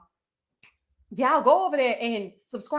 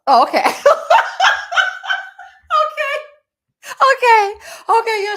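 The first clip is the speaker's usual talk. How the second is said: laughing realization; 8 dB louder than usual. Real, not acted.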